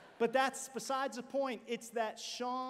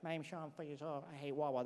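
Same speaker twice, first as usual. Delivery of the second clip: put-on lower, softer mumble of a shy boy; mumbled kid voice; 6 semitones lower, 6 dB quieter than usual. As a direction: mumble it like a bored four-year-old. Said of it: "and it was like".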